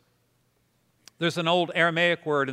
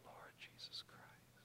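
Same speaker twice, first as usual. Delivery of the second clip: whisper; 26 dB quieter than usual. Shown as a demonstration. Pastor repeating breath prayer with congregation, whispered. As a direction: whisper it on one breath in, then out. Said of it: "Now whisper it again".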